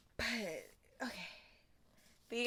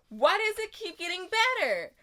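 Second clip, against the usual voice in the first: high, loud thrilled question; loud high giddy wonder; excited disbelief; 7 semitones higher, 6 dB louder than usual. Real, not acted. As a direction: exclaim it overjoyed. Overwhelmed joy.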